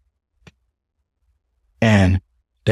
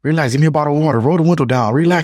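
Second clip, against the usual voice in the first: retold yelling; 7 semitones higher than usual. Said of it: "Yeah, I was yelling".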